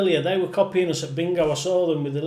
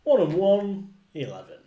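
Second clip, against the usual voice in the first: bingo caller; 3 semitones higher than usual. Acted. Calling bingo numbers with rhymes.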